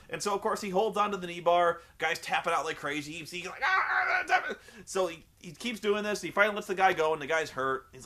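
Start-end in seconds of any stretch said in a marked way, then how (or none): 3.6-4.2 high strangled "aah"; hammed-up high howl of pain; mock pained cry. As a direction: imitate a trapped wrestler wailing. Imitating man tapping frantically.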